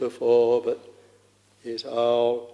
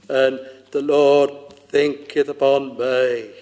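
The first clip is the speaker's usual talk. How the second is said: swelling preaching; 4 dB louder than usual. Acted. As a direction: stretch it out and let it swell. Preacher swells on God's love.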